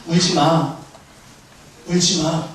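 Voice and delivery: low, restrained command, spaced out; lightly voiced Jesus, pitched well down. Jesus to the few weeping followers, low and solemn. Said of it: "이상한 말씀을 하셔".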